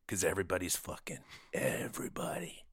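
soft, voiceless conspiratorial aside; whispered punchline. Acted. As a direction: whisper it like a naughty secret.

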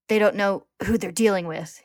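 forceful, gritted-teeth defiance. A narrator's reading. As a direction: grit it out loudly through pain. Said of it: "he grits out".

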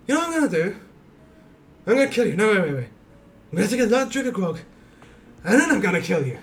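put-on high, slurry drunken orc greeting. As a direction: play a tipsy orc calling out. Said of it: "Gerbu the Drunkard".

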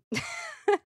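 short high laugh; incredulous laugh. Real, not acted. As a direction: let a real, high giggle escape in disbelief. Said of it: "I'm laughing 'cause it's absolutely insane".

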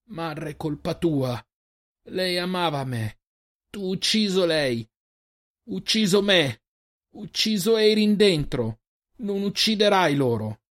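a wounded monster finding words, rising toward defiance; broken creature speech. Acted. Girin facing his father: high halting creature voice building in force.

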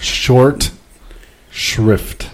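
"Short", pause, then "shrift"; slow, careful enunciation. Shown as a demonstration. Podcaster correcting co-hosts' mishearing, slow separated enunciation. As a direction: separate "Short" and "shrift" as two distinct words.

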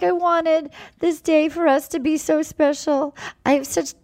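high-pitched mock weepy voice; put-on high whimper; imitated sobbing. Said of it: "she goes to him and she's sobbing".

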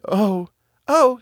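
put-on high girl's voice; little sister's voice. Marlene hears the bird sing her name, high girlish cry. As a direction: exclaim it high as the girl.

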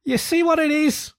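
very high exasperated appeal; despairing Rat. A narrator's reading. Rat appealing to Mole over Toad's head, despairing. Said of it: "The rat turned from him in despair".

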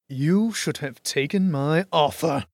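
slow, gloating villain voice; triumphant villain. A narrator's reading. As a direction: gloat over the beaten hero. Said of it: "with a cruelly triumphant grin".

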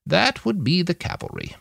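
high-pitched woman's figure, understated; Nanayazi, knowing. Acted. Nanayazi explains the cavalry's arrival to Manny, high and restrained.

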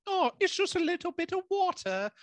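high put-on British accent; a squeaky fake British accent; mock British accent. Astronauts shrugging off the water, in mock British. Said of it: "the moment when they're like".

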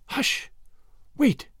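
put-on hushed warning from a girl; urgent hushing. A narrator's reading. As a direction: shush him urgently. Annette silences Tommy while hiding.

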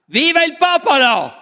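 high, loud call from a window; rallying shout of viva il popolo. Retold or imitated. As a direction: yell it out to the whole square.

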